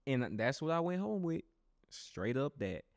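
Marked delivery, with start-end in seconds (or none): none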